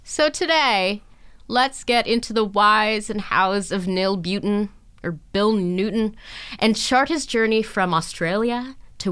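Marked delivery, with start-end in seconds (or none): none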